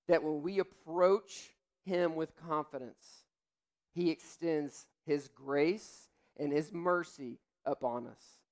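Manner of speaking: ordinary talk, nothing marked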